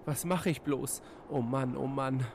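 high, anxious little voice; fretting to himself; worried fright. Acted. Vanja frets before the bear, high and worried.

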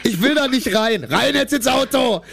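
sing-song it like a tipsy friend; drunk yelling outside. Pretend. S0 acts drunk scene. (betrunkener Singsang)

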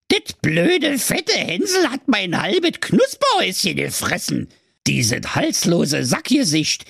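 high-pitched, ranting witch voice; angry witch. A narrator's reading. Witch complains about Hansel eating her house, angry. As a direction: rant high in Berlin dialect like a furious witch. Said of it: "sprach die Hexe voller Zorn".

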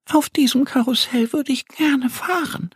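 Annie longing. Acted. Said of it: "sagt Annie sehnsüchtig".

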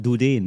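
"du den" as du-DEEN, long stressed ending; Frenchify "du den" with stress on a stretched "déén"; new stress. Shown as a demonstration. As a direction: say "du den" as one word stressed late. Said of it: "wie wir jetzt sagen".

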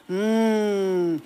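long relishing Mm hum. Shown as a demonstration. Coach humming with delight as example. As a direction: savour the hum with pleasure. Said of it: "je mehr Wollust, desto besser".

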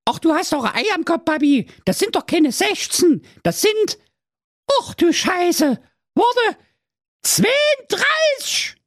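put-on very high, rising girl voice; panicking daughter. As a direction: realise the count and lose it.

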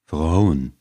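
a touch of grumbling anger; angry mutter. Acted. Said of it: "murmelte er zornig".

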